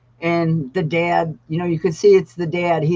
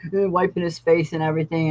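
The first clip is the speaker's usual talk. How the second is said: ordinary delivery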